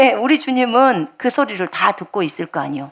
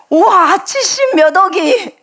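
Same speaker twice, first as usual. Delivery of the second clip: higher, louder, drawn-out gasp of amazement; awed wonder; 9 semitones higher, 3 dB louder, slower than usual. Real, not acted. Preacher marvels to the congregation, higher and louder in awe. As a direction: marvel at the sheer number. Marvels at God hearing billions.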